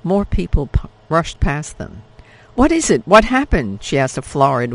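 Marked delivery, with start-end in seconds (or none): 2.5-3.8 much higher, louder panicked asking; frightened question. Acted. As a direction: grab a stranger amid the panic. Panicked crowd, Isabel asks.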